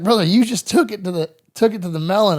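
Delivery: loud, high urgent reply; a buddy telling him he's hit. Retold or imitated. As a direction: yell it over the noise. Buddy answering wounded soldier.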